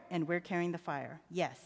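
low, soft father's confirmation; reassuring father. A narrator's reading. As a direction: quietly affirm it for a child.

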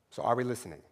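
ordinary talk, nothing marked

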